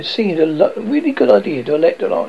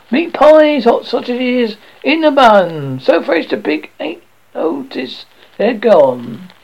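higher and louder, calling out wares; street-hawker shout; 8 semitones higher, 3 dB louder than usual. A narrator's reading. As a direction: cry your pies to the whole street. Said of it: "raised his voice to shout".

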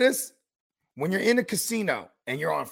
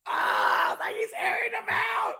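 high exclaim; slower than usual. Real, not acted. Reacting to Sherman's rant.

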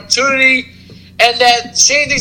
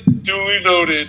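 flat dismissive Shane; 4 semitones lower, 4 dB quieter, slower than usual. Retold or imitated. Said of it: "all he can say is".

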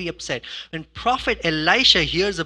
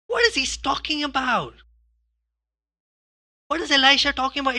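indignant disbelief; 8 semitones higher, 3 dB louder than usual. Retold or imitated. Voicing Naaman's disbelief.

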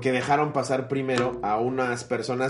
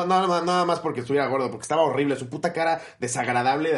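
ordinary talk

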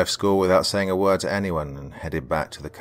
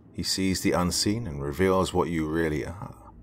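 usual speaking voice

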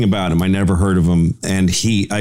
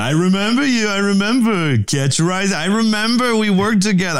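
mock Larry; 11 semitones higher than usual. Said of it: "he said".

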